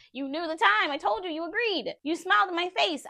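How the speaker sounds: high-pitched, exasperated accusations; indignant reproach. Retold or imitated. Acted reproach over broken promise.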